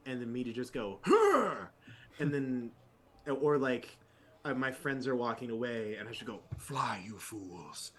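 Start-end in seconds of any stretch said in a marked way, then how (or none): 1.0-1.5 sharp startled huh; a high loud yelp of surprise; Gandalf grunt. Retold or imitated. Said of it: "noises that Gandalf would make". 6.6-7.9 slow, low wizard command; a light Gandalf impression. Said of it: "noises that Gandalf would make".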